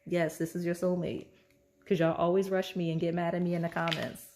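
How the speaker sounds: normal talking voice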